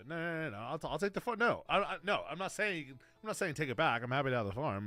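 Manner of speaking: hammed-up panicked objection; defensive backpedalling. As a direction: hastily talk Grandpa out of taking it back. Backpedals to grandpa.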